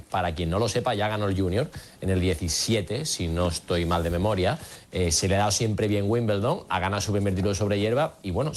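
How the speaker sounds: ordinary talk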